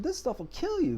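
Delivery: shocked disbelief. Retold or imitated. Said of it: "I'm like".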